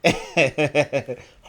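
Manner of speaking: witchy cackle, hammed up; evil witch laugh. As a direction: cackle like an old hag. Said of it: "let me do my evil witch laugh".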